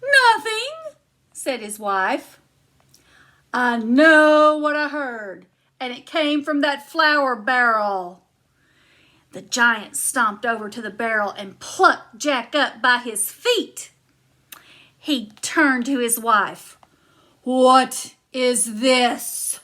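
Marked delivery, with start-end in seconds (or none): 0.0-1.0 much higher, loud innocent denial; innocent giantess. Acted. Wife covers for Jack. 3.5-8.2 big giant stomping in for lunch; booming giant. Acted. Giant insists. 17.4-19.6 loud, slow booming chant; big giant stomping in for lunch. Acted. Giant holds Jack.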